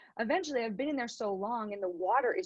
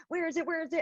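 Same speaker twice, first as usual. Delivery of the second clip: softer, hushed mock panic; panicked search; 5 semitones higher, 5 dB quieter, faster than usual. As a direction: mutter it as if running out of air. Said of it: "And I'm panicking".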